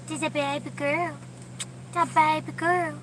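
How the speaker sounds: high doting dog talk. Real, not acted. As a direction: coo at the pet. Baby talk to her dog.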